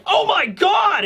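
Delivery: high shocked gasp of a cry; shocked shout. Pretend. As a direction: cry out in horror. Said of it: "you're like".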